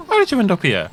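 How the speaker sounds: very high, loud mock woman; hammed-up high female voice. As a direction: push up into falsetto as a woman, laughing at it. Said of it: "try to do a woman voice".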